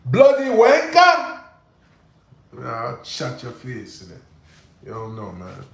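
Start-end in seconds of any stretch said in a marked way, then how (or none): 0.1-1.4 high, loud, slowed British "bloody wanker"; mock British outrage. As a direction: ham up an offended Englishman. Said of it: "He said".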